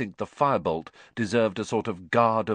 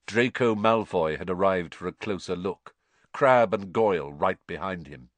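plain reading voice

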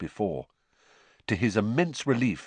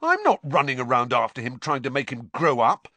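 louder, angry shout; loud outburst; 6 semitones higher, 6 dB louder than usual. A narrator's reading. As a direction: let it burst out, scaring the owls. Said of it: "so loudly that several owls".

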